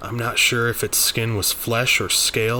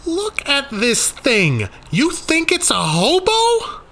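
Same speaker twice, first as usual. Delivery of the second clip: much higher, louder, slow disbelief; can't believe what she just said; incredulous; 7 dB louder, slower than usual. Acted. Daniel to Rainey about the monster, incredulous. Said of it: "I said incredulously".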